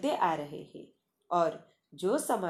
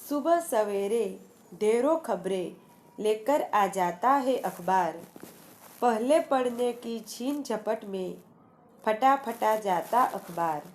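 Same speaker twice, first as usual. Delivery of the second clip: rhythmic, sing-song poem reading; poem recitation; 3 semitones higher, 3 dB louder than usual. Shown as a demonstration. Teacher reciting the newspaper poem to students, rhythmic recitation. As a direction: recite each line with a lilt, pausing at the rhyme.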